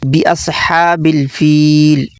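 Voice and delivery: slow, careful "बि अस्हाबिल फील" with a short i; careful correct bi. Shown as a demonstration. Teacher slowly modeling the correct reading. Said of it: "बे नहीं बी".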